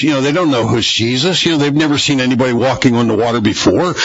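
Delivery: ordinary talk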